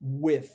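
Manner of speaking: "with" ending in a voiceless th, tongue between teeth. Shown as a demonstration. Teacher demonstrating the unvoiced TH in "with". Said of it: "você tem que treinar isso em inglês".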